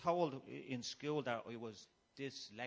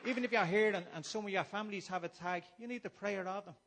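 louder, higher, preaching at full force; fiery preaching; 7 semitones higher, 7 dB louder than usual. Acted. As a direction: rouse the congregation like a preacher. Preaches to the congregation.

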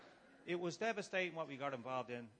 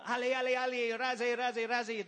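much higher and louder mock shouting; imitated barked commands; 10 semitones higher, 10 dB louder than usual. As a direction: bark the French orders like an officer. Imitates barking French officer.

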